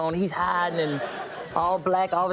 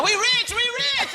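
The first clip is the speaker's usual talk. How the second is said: high, slow sing-song chant; mock the family gloating; high slow we rich; family cheering; slower than usual. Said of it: "It's my".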